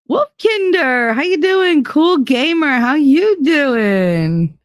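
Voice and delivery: high, bright greeting to chat; sing-song greeting. Real, not acted. Streamer greeting her viewers, high and sing-song. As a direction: call out a cheery hello, stretched.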